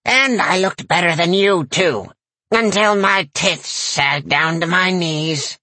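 slow, high cackling granny; a crude crone bragging; cackling old lady. A narrator's reading. Granny character voice.

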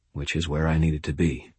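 plain reading voice

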